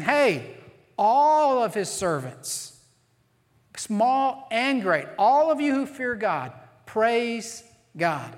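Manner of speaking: calling everyone to praise; worship leader call. Retold or imitated. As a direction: rouse the congregation like a worship leader. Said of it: "almost like a worship leader".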